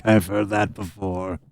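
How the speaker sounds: low, slow, subdued farmer voice; resigned farmer, trailing down slowly; simple farmer, indifferent. Acted. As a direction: wash your hands of them wearily.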